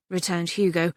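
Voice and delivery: ordinary delivery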